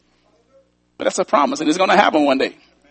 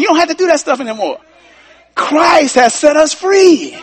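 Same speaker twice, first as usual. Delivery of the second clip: building preacher climax; 5 semitones higher than usual. Acted. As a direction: pitch climbs higher line by line to a shout on free. Preaching at full force.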